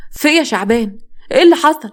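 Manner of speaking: wife jolted awake, voice climbing in alarm; startled. Acted. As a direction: wake up frightened and demand what happened. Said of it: "فاطمة قامت مخضوضة".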